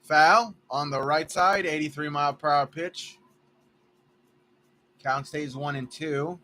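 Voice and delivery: lively baseball play-by-play; play-by-play call. Acted. Announcing his ballgame to friends, live broadcaster style. Commentates his video game.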